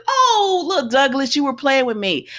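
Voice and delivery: Donna's delight. Retold or imitated. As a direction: play her as charmed and surprised. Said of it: "then she says".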